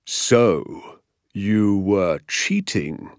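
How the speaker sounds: very slow, measured menace; ominous, deliberate teacher's voice; grave accusation. Acted. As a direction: drag out the verdict.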